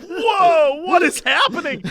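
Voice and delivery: hammed-up alarm; mock shock. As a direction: react to something creepy. Viewer shocked reaction.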